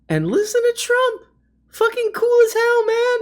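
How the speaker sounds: very high, hammed-up bro voice; mock cool-guy voice. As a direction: squeak out a cartoonish cool-dude line. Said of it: "he's just like".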